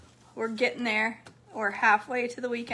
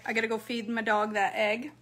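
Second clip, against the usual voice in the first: ordinary talk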